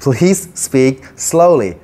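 "Please speak slowly" drawn out very slowly, each word clear; slow, careful English model sentence. Shown as a demonstration. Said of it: "ใส่ please เข้าไปข้างหน้า".

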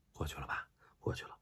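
whispered aside, almost no voice; sly whisper. Retold or imitated. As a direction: hush it like you're getting away with something. Sneaking past after fake apology. (得逞般的耳语)

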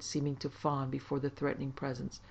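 plain reading voice

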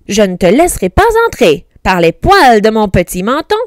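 loud, brisk, defiant pig; curt refusal. A narrator's reading. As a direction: refuse firmly and fast. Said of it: "dit sèchement le cochon".

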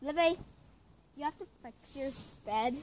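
ordinary talk, nothing marked

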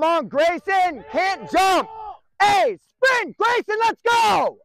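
high, drawn-out coach's yell; urging shout. Real, not acted. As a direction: holler to drive the player on. Coach urging player to sprint.

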